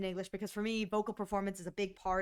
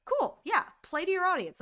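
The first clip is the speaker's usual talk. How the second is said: breezy mock approval; 8 semitones higher than usual. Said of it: "And I go".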